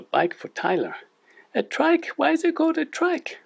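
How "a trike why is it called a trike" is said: squeaky, high cartoon puppet sidekick; chirpy little puppet, much higher. Puppet co-host Lani speaks.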